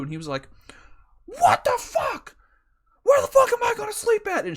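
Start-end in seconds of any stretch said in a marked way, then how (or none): 1.2-4.5 high, loud shout of an outraged man; screaming rage. Retold or imitated. Irate dad to the repo men, screaming. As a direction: yell it at full blast, pitched way up. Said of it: "He's fucking screaming".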